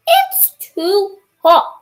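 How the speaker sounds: high, loud, slow moan about the heat; loud complaint.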